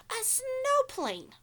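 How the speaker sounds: stretched, high excited reveal; big reveal. Acted. Teacher revealing the snowplane to kids, excited. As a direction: draw it out slowly like a grand surprise.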